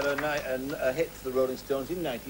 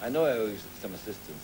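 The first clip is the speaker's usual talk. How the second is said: ordinary talk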